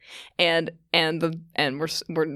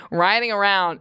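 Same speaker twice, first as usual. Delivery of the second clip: usual speaking voice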